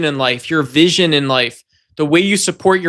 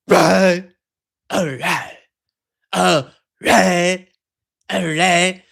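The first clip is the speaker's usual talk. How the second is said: strange altered voice, drawn out; mock voice, some lines near a whisper; odd put-on voice; much slower than usual. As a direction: keep repeating it in a weird mock voice. Repeated odd voiced affirmations.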